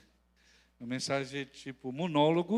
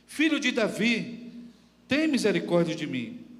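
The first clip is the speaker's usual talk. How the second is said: raised plea in a scripture reading; crying out more insistently; 5 semitones higher, 5 dB louder than usual. A narrator's reading. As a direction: open high on the name, then fall away. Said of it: "ele clamava cada vez mais".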